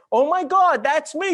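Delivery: very high, loud mock astonishment; excited epiphany. Telling men how they'll react to the book, high excited exclaim. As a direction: shriek it as if struck by recognition. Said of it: "you will go".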